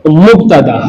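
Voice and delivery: usual speaking voice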